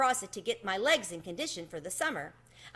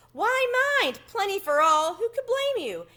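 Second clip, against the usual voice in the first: high squeaky sympathetic mouse, much louder; 11 semitones higher, 6 dB louder than usual. A narrator's reading. Tucker reassures Chester about the picnic, high and squeaky. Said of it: "said Tucker Mouse sympathetically".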